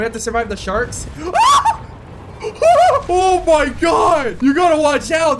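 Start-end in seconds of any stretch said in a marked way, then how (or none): 1.1-3.0 high, loud shriek of fright; sudden high yelp; startled scream. Real, not acted. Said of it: "I'm, like, scared". 3.1-5.4 loud, higher startled exclamation; alarmed, raised voice; scared. Real, not acted. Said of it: "I'm, like, scared".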